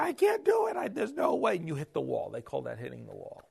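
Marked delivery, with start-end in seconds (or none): 0.0-1.6 despairing inner voice. Retold or imitated. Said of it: "you start thinking".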